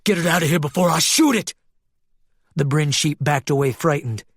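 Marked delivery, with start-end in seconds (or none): 0.0-1.5 fast, agitated order; frantic threat. Acted. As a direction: rush it out as an armed warning. Maglev howls, drawing his gun.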